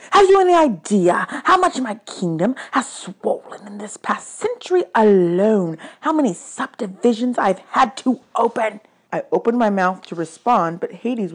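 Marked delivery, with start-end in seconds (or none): 0.1-8.8 exasperated god, pitched up; indignant god of the dead. Acted. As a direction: complain like an overburdened underworld ruler.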